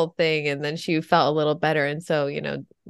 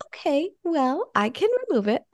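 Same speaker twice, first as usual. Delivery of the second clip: soothing mother; 10 semitones higher than usual. Retold or imitated. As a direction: humor a scared toddler. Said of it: "So I was like".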